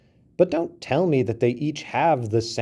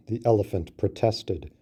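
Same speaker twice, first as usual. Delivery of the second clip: plain reading voice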